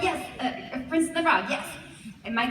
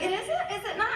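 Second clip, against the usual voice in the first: small, high-pitched questioning; sheepish surprise; 10 semitones higher, 5 dB quieter than usual. Retold or imitated. Actor retelling her reply to the director, meekly puzzled. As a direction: act out mild, embarrassed disbelief.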